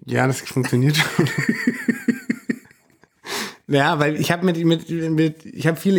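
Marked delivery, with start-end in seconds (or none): 1.3-2.5 high, giggly laugh; amused laugh. Real, not acted. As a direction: laugh at your own answer. Joking about mumbling in writing. (hohes, amüsiertes Lachen)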